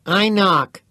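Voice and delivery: Teddy's smug call. A narrator's reading. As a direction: announce it like a winner.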